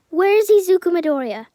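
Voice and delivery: loud, high urgent question; mother yelling at the receptionist; frantic demand. Acted. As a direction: demand it at the desk in panic. Mother demanding at hospital desk.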